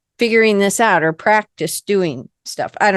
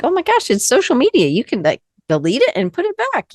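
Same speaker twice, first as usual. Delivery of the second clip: quick, high-pitched "oh my gosh" reaction; incredulous, rushed and pitched up; exasperated disbelief; 7 semitones higher, faster than usual. Retold or imitated. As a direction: protest in a high, hurried voice at an overblown fuss. Acting out exasperated reaction to boss.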